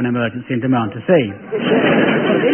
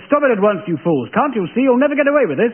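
higher, hammed-up posh adventure-hero scolding; camp English hero; 8 semitones higher than usual. An imagined posh hero scolding the police, hammed-up and higher. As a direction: play the stiff-upper-lip hero confronting the villains. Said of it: "It was as though I'd said".

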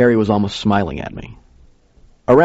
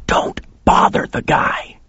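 slow, hissed whisper; urgent whisper through the teeth; hissed warning; slower than usual. A narrator's reading. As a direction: hiss it slowly as a secret warning. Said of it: "he hissed at me".

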